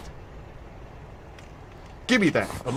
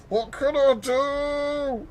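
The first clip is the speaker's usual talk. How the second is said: high, slow drawn-out whine; hammed-up child whine; whiny kid; 11 semitones higher, slower than usual. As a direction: stretch it out like a pestering child. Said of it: "She just used to flip".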